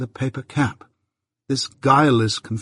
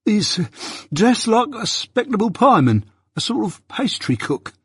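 much higher, suddenly eager; brightened; 11 semitones higher than usual. A narrator's reading. Mike brightly describing the witness to Jaggers. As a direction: perk up with a bright idea. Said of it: "Mike brightened".

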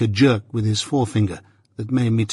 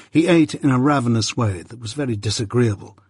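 ordinary delivery

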